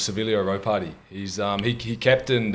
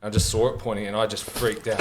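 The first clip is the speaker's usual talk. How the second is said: ordinary talk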